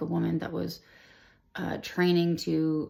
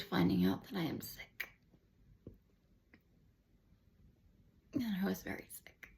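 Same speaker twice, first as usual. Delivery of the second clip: whispered, much softer, words nearly gone; real hushed whisper; 8 dB quieter than usual. Real, not acted. Recalling being told of leukemia, real hushed whisper. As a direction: let it fall to a bare whisper. Recalling cancer diagnosis, voice drops.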